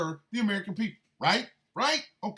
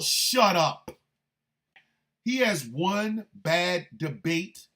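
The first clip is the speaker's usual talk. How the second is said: ordinary delivery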